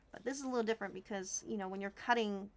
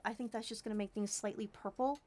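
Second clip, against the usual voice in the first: ordinary talk, nothing marked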